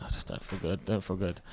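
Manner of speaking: low, soft retold complaint; feeling unwell. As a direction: mimic feeling poorly, voice dropped right down. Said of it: "I was not myself".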